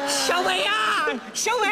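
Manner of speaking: high, urgent call; alarmed calling out. Acted. Manager summons young man about the crying customer, high and alarmed. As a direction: call for help in a panic.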